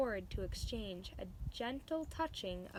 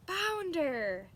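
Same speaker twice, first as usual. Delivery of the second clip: high, loud happy shout; enthusiastic yell; 8 semitones higher, 8 dB louder than usual. A narrator's reading. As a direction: cheer out the name. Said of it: "yelled Samson enthusiastically".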